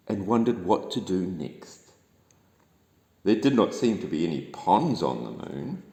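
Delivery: ordinary delivery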